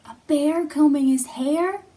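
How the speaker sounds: high, loud mock surprise; mock amazement. Teacher acts amazed at the goose for children. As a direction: act amazed for the kids.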